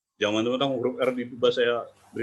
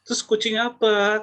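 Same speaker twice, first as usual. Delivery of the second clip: much higher, exasperated customer asking; high impatient shopper; 11 semitones higher, 4 dB louder than usual. Retold or imitated. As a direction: push the question up high in disbelief.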